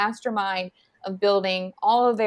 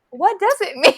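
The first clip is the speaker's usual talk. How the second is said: innocent question; 10 semitones higher, 6 dB louder than usual. Retold or imitated. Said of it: "she was like".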